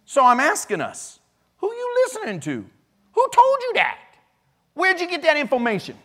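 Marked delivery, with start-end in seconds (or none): 1.6-6.0 high-pitched Southern-dialect challenge; sassy mimicked dialect. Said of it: "Okay, so I'm mimicking".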